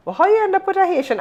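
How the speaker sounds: high-pitched nosy question; mock incredulity. Somebody questioning her choice of a Haitian husband, mock incredulous. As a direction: imitate someone baffled and prying.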